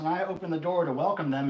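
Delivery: ordinary talk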